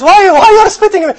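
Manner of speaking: loud, high-pitched startled question. Retold or imitated. Student questioning the spitting scholar, startled. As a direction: ask it high and loud, taken aback.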